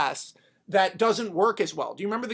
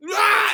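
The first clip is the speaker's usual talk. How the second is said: high, loud battle yell; acted-out roar of attack; 14 semitones higher, 8 dB louder than usual. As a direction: lunge in with a wordless scream. Possessed Steve Trevor attacks.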